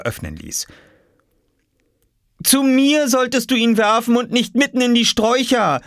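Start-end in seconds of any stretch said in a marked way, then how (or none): 2.4-5.8 high, exasperated scolding call. A narrator's reading. Mother below the window to her son, calling up crossly. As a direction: scold in a raised, high-pitched voice. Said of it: "rief Mama von unten".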